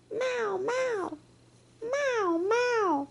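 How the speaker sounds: high little meows; baby jaguar meows. Acted. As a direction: mew like a tiny cub.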